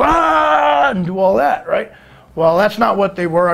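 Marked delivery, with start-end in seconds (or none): 0.0-0.9 high, held "aah"; pitched-up pretend holler; mock yell. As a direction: cry out in the wrestler impression. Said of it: "I can go".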